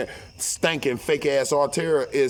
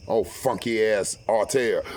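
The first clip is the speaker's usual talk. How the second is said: ordinary delivery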